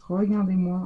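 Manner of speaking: normal talking voice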